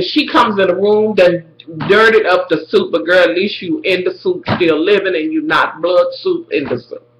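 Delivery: ordinary talk, nothing marked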